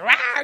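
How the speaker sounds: loud imitated cougar roar. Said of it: "She's like".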